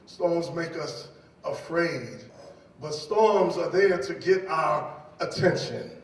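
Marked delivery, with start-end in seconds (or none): none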